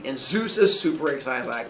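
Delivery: normal talking voice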